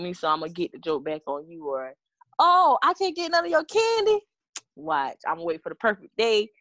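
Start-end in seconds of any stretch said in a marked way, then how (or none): 2.4-4.2 mocking whine. Mocking a sulky friend.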